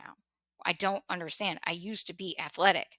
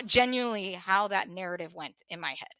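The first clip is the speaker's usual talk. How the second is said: ordinary talk, nothing marked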